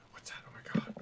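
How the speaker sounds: hushed imitation of onlookers; murmured whispers. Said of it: "any murmurings or whispers".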